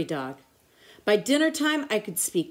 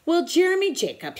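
higher pirate greeting; pirate captain; 10 semitones higher, 3 dB louder than usual. A narrator's reading. Pirate captain greets the boy: higher hearty voice. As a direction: greet him like a swaggering captain. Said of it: "he said".

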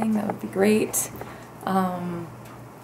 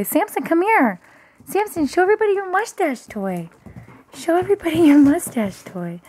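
higher, louder sing-song dog call; 7 semitones higher, 4 dB louder than usual. Real, not acted. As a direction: coax the dog to show his toy. Calling the dog over.

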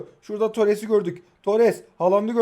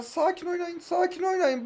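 high, soft pleading to the team; high coaxing; 11 semitones higher, 4 dB quieter than usual. Real, not acted. Player urging his team to play calmly, high coaxing. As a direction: coax them softly in a high voice.

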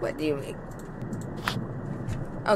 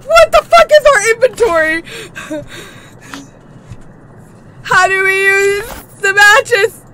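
panicked questions; 6 semitones higher, 9 dB louder than usual. Real, not acted. Panicked fumbling in horror game.

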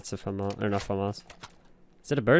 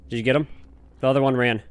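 usual speaking voice

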